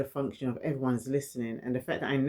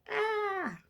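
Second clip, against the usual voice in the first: frustrated cry; 3 dB quieter than usual. Retold or imitated. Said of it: "is like".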